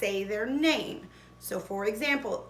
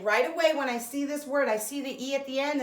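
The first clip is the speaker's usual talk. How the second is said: usual speaking voice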